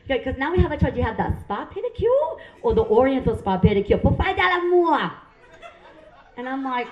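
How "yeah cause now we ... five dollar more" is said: imitated Vietnamese nail lady, swelling on the upsell; salesy put-on Vietnamese accent. Nail lady upselling the spa pedicure, Vietnamese accent. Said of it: "I love their accent".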